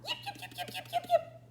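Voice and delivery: very high, tiny yips; Schnitzel von Krumm yips. Acted. Small dog yips at the sound, very high. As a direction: yip like a little dachshund. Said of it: "said Schnitzel von Krumm".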